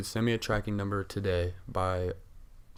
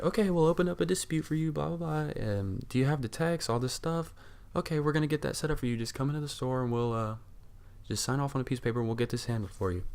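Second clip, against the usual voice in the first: higher-pitched, angelic customer service voice; sweet bank lady; 6 semitones higher than usual. Retold or imitated. Bank lady reassures a customer about the dispute, sweetly. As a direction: imitate a sweetly helpful phone agent. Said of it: "Just the sweetest angel you'll ever talk to".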